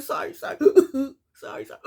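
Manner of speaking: hammed-up sobbing apology, words nearly voiceless; mock bawling. Recapper imitating the father crying in his confessional, mock bawling. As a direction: imitate someone blubbering between bursts of sobs. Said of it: "He's literally bawling".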